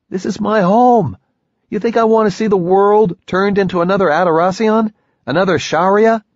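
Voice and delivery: a man defending his home, loud and desperate; desperate outburst. Acted. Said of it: "sudden brief desperation in his face".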